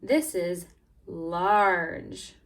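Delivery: slow, weighty "large"; stress on large. Shown as a demonstration. Teacher to class, slow emphatic "large". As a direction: lean hard into "large". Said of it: "this is the opposite of small".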